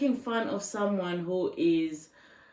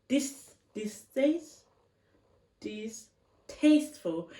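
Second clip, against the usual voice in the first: slow, softer, syllable by syllable; sounding it out; 2 semitones lower, 7 dB quieter, slower than usual. Real, not acted. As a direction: feel out the word haltingly, unsure of it. Sounding out an uncertain word.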